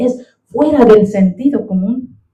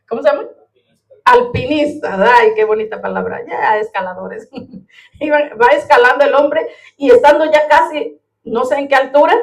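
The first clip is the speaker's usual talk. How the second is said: ordinary talk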